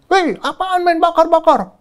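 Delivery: high mock yell at the crowd; retold shout. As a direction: call out sharply to stop them. (teriakan menghardik massa)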